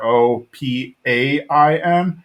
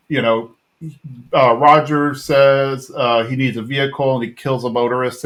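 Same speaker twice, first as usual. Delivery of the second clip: normal talking voice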